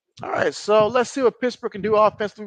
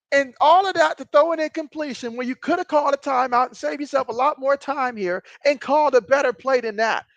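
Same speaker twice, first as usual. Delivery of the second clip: play-by-play building higher and faster as the play unfolds; live play-by-play; 4 semitones higher than usual. Acted. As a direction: call the play, rising with the tension, then snapping into critique.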